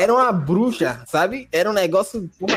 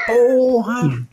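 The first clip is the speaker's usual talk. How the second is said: surprised; 6 semitones higher, 4 dB louder than usual. Retold or imitated. Girl reacts to dead fish.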